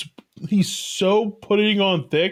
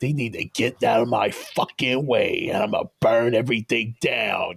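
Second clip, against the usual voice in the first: imitating a gruff Chicago tough guy, much lower; deep Chicago accent; 9 semitones lower than usual. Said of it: "he would have a deep Chicago accent".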